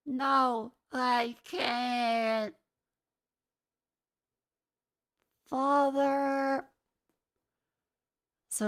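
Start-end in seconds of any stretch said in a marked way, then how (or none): 0.0-6.6 high, drawn-out hammed-up anguish; mock melodrama. Streamer mimicking Steve's overacted lines, mock-anguished. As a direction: imitate bad overacting, stretch every word.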